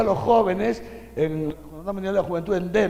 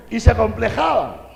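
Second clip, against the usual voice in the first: laughing; 3 semitones higher, 5 dB louder than usual. Acted. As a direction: chuckle the words out.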